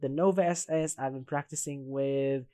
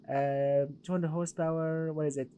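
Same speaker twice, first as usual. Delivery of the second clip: ordinary talk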